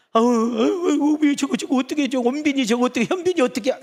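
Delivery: high loud mock wailing over the drama hero; imitating weepy drama viewer. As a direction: squeal like a viewer sobbing at a TV drama. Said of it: "막 아주 막 엉엉 짜짜". (드라마 보며 우는 시청자 흉내, 높고 크게)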